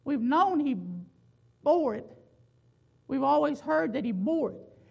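"bore" hit as the familiar point; stress on bore. Shown as a demonstration. Preacher recalls the familiar reading, stressing "bore". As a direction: land on "bore", the old emphasis. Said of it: "put a little more emphasis".

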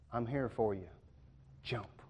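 put-on calm the child into jumping; reassuring parent. Parent coaxing child from water.

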